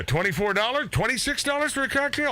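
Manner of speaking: incredulous. Real, not acted. Scoffing at bar prices.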